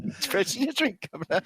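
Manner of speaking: a lighter echo of the Cockney line; half-hearted Simpsons Brit impression; poor British accent. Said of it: "do a poor imitation".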